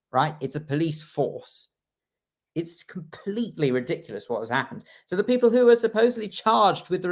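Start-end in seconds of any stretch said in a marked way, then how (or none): 0.4-1.7 police force, slow, punching force; slow pointed police force; stress on force. Shown as a demonstration. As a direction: hit force hard and slow. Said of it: "the emphasis on the word force".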